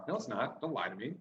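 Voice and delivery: usual speaking voice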